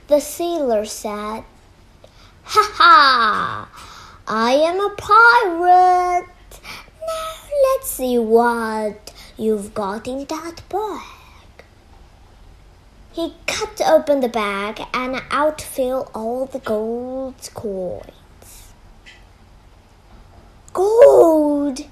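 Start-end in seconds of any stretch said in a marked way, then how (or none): 2.4-11.2 loud, hammed-up pirate voice with a big "ha ha"; gloating pirate. Pirate revealing himself in story. 20.7-21.8 loud, drawn-out pirate cry; pirate's shout. Acted. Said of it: "shouted the pirate".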